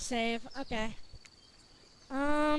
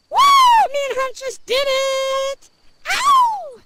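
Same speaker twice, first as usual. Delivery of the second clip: high, loud, stretched-out cheer; triumphant howl; 11 semitones higher, 14 dB louder, much slower than usual. Acted. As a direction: crow it out, ending in a howl. Crowing over sleeping with Huntress.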